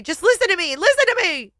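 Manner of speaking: high, loud, desperate scream. A narrator's reading. Wife screaming at husband to listen, desperate scream. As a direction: cry out to be heard, pitch climbing. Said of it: "I scream at him".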